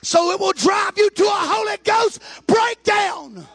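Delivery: high, loud preacher shouting to the church; full-force preaching. Acted. Preacher shouting to the congregation about the Holy Ghost, full force.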